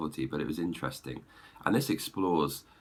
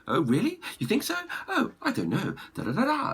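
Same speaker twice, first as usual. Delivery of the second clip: squeaky, much higher pantomime chatter. Retold or imitated. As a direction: ham it up like a pantomime. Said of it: "It's very pantomime".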